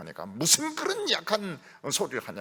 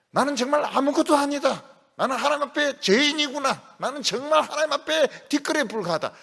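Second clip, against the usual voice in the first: raised, earnest confession of nothingness; a touch raised, pleading self-confession; humbled disciples' inner voice; 9 semitones higher than usual. Retold or imitated. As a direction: voice the disciple realising he is a sinner.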